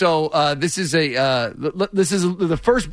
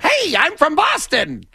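slow, high, hammed-up Boston accent; a mock Boston guy, drawn out and high; 10 semitones higher, slower than usual. Mocking Boston callers in a thick Boston accent. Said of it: "great Boston accents".

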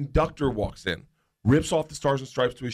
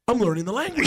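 high, thrilled inner voice; mock-proud, high-pitched glee; delighted realization; 8 semitones higher than usual. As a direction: marvel at your own progress.

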